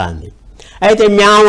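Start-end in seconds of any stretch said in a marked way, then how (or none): 1.1-1.5 a high little meow; mock cat meow. A narrator's reading. Cat answers the dog with a meow. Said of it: "అని అంది".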